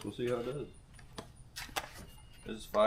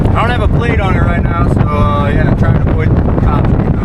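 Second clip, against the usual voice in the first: raised voice over road noise; shouting over wind; 3 semitones higher, 5 dB louder than usual. Real, not acted. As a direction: talk loud over the wind. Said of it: "Sorry about the wind noise".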